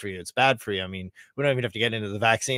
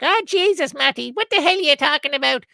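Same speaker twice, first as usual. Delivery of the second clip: high, loud exasperated Irish mom; Irish mother; 6 dB louder than usual. Retold or imitated. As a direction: imitate mom scolding in an Irish accent. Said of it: "She actually speaks with an Irish accent".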